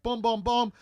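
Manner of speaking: loud, high punch sound effects; imitated punch noises. Storyteller acting out a spring break fight, loud imitated punches. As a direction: punch out each boom.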